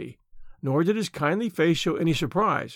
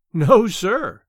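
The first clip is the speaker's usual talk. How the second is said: "No, sir" punched out louder; emphatic; 3 semitones higher, 7 dB louder than usual. A narrator's reading. Lady contradicting the man who dismissed her romance, "No, sir" loud and emphatic. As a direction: cry "No, sir" with force on each word. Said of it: "she cried with emphasis".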